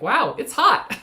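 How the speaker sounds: mock-astonished quote, breaking into a laugh; amused surprise. Retelling own reaction to the heat.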